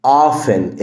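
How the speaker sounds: "Often" as "offen", no T; silent T. Shown as a demonstration. Teacher models the word, "Often" with silent T. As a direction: leave the T out of "Often". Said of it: "ال T هنا silent".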